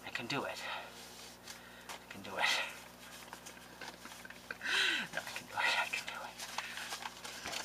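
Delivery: soft, sinking whispered pep talk; mock self-pep. Crafter jokingly steels herself to give away paper, whispering. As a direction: coax yourself in a shaky hush.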